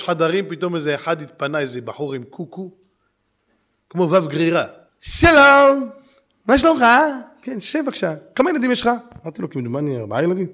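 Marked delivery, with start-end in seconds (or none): 5.0-8.9 higher, louder sales clerk greeting; salesman voice. Retold or imitated. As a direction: greet the buyer like a slick salesman. (מוכר חלקלק בקול גבוה)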